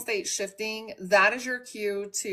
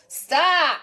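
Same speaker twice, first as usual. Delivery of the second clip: loud, high one-word command; sharp stop; 7 semitones higher, 7 dB louder than usual. Real, not acted. Host orders her barking dog to stop, loud and high.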